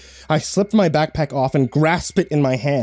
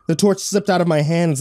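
ordinary delivery

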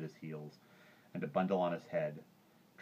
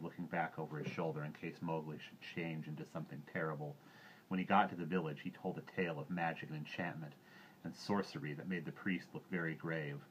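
ordinary delivery, nothing marked

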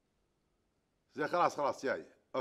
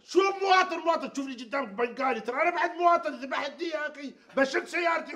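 high, loud outraged yell; man called a foreigner yells that he's a citizen too; furious shout; 7 semitones higher, 5 dB louder than usual. Acted. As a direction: blast him, insulted. Furious retort about being citizen.

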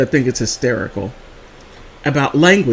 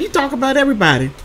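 quick, higher quoted line; quoting the nurse; 10 semitones higher, faster than usual. Retold or imitated. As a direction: tease like the nurse scolding you.

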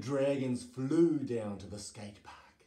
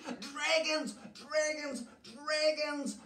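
very high, panicked townsfolk cry; high-pitched acted alarm; storybook scream. A narrator's reading. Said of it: "they screamed".

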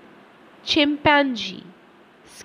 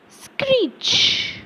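high screechy squeal, much higher; screeching bat; 13 semitones higher than usual. Shown as a demonstration. Acting a bat in exercise.

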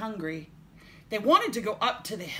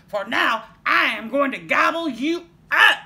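loud, raised troll threat; 6 semitones higher, 13 dB louder than usual. A narrator's reading. Troll threatening to gobble the little goat, bellowing. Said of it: "said the troll".

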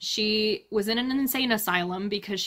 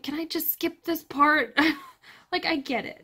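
high, pained mock pleading; exasperated plea; 6 semitones higher than usual. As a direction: act out begging to be spared, pitched up. Voices her exasperated thought while listening.

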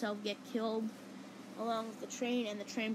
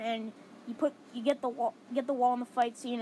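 ordinary talk, nothing marked

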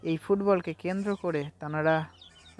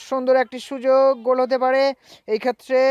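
higher, louder rising commentary call; excited; 7 semitones higher, 8 dB louder than usual. Acted. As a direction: build the thrill of a near goal. Commentator sees a scoring chance.

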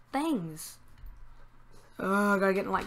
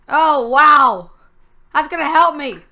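loud, high burst of delight; thrilled; 5 semitones higher, 10 dB louder, slower than usual. Real, not acted. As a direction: cheer loudly at a lucky find. Delighted at a helpful game find.

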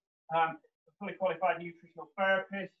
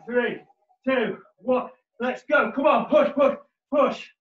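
gym bro; 5 semitones higher, 9 dB louder, slower than usual. Acted. Fitness coach calling workout cues.

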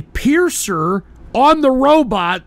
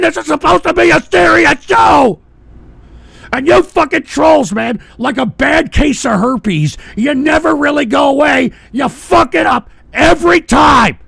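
loud, higher ranting shout; angry rant; 3 semitones higher, 4 dB louder than usual. Pretend. Host scolding trolls who ruin the show, angry rant. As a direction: hammer every line at the trolls. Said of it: "I know that I'm angry".

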